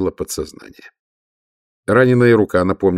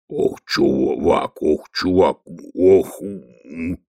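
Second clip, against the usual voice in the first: low, slow troll rumble; lightly voiced lumbering troll; 3 semitones lower, much slower than usual. Detritus gropes for a word.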